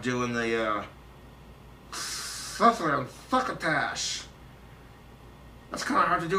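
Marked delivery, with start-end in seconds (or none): 1.9-4.2 slow, spluttering cartoon lisp; wet, lisping cartoon cat; Sylvester the Cat. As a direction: spit out the s sounds like a cartoon cat. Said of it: "Sylvester's voice".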